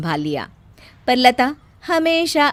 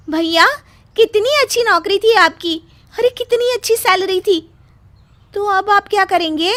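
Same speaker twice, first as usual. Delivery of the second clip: much higher, anxious questions; dismayed sister; 11 semitones higher, 5 dB louder than usual. Acted. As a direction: fret over the lost salary. Sister dismayed at job loss.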